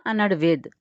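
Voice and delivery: ordinary delivery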